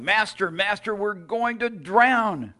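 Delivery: fearful disciples shouting over the storm. A narrator's reading. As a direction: call out as if the boat is sinking.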